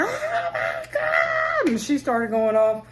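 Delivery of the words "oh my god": very high, slow, drawn-out gasp of "oh my God"; hammed-up scandalized scream; shocked shriek. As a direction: shriek it out in mock horror, stretching every word. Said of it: "the lady was like".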